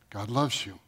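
soft, tender repeat of "God loves you"; hushed assurance. Acted. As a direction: bring it down to an intimate hush. Repeated assurance, dropped low.

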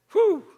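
high, loud exclaimed whew; excited whew. Real, not acted. Preacher marveling at the word's meaning, loud high whew. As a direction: let out an amazed whew.

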